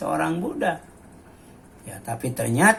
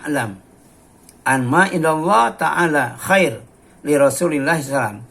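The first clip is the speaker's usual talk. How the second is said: louder, declaimed Arabic recitation; hadith recitation; 6 dB louder than usual. Acted. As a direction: proclaim the Arabic text to the congregation. Reciting Ummu Aiman's answer. (membaca hadis dengan lantang)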